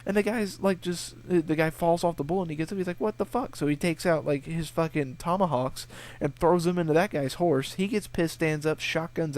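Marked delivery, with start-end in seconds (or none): 3.0-3.5 higher, startled what-the; mock stunned cry after the fall; shocked outburst. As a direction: rider thrown from his bull, startled and outraged. Bull rider knocked off.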